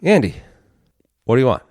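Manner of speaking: mock bartender shout, name first; retold calling out. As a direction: hail a regular across the bar, acted out. Chief calls out to him, retold.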